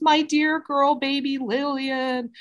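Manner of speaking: mock wailing. Wife faking grief in front of the mom, hammed-up wail. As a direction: overact a fake grieving mother's cry. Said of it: "And so she screams".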